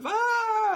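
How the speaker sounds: a sudden high loud wail; mock child's cry, high and loud. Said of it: "they come and they go".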